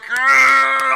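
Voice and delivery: loud, high mock roar of wrath; exaggerated drawn-out yell; angry growl. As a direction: imitate a wrathful God with a shouted "argh". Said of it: "You think God's all like".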